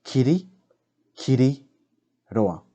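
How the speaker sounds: "Kiri, kiri, roa" in three groups with pauses between; word split into three spoken groups; grouped in chunks. Shown as a demonstration. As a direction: say it chunk by chunk, pausing after each. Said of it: "group it together".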